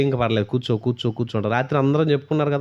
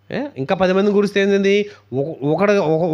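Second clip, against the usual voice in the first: usual speaking voice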